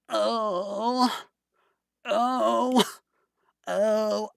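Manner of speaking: a half-hearted attempt at her little "oh"; mimicking Fauna. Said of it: "I can't mimic her voice".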